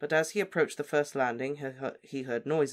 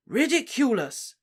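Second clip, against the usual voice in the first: high, slow, commanding spell; firm and clear; 11 semitones higher, 7 dB louder than usual. A narrator's reading. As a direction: cast it firmly and clearly. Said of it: "very firmly and clearly".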